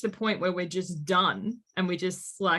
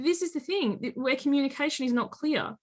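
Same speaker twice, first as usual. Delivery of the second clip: ordinary talk, nothing marked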